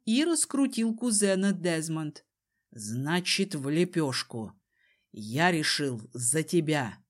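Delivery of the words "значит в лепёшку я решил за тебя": low, matter-of-fact man's voice; menacing Desmond. A narrator's reading. Desmond decides Kiran's fate, low and menacing. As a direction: pass sentence in a deepened, calm voice. Desmond pronounces his threat.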